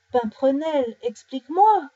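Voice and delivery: acted exasperated call; indignant demand. A narrator's reading. Bear demands an explanation.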